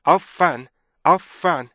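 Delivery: OF, short stop, FUN; two separate sounds. Shown as a demonstration. Teacher modelling for students, OF and FUN separated. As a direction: give OF and FUN a strong beat each. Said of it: "이렇게 발음해 주셔야 돼요".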